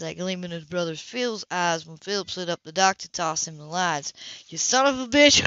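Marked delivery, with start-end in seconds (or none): 4.5-5.5 louder, higher, snapped out; angry insult. Acted. Brother confronts Philip on the dock, angry and loud. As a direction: spit it at him in anger.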